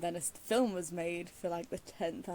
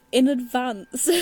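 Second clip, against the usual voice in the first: laughing it out, a bit louder; 4 semitones higher, 9 dB louder, slower than usual. Real, not acted. Correcting her own word slip to viewers, laughing. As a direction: blurt it through a laugh at the mix-up.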